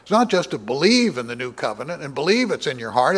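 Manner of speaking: normal talking voice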